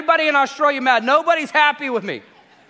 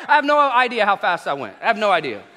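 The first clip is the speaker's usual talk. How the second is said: ordinary talk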